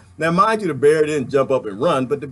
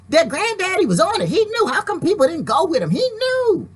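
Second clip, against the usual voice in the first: much higher, admiring grandchild voice climbing higher still; proud grandkid; 10 semitones higher than usual. Pretend. Grandkids looking back praise their granddaddy, high admiring voice. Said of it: "your grandkids to look back and say".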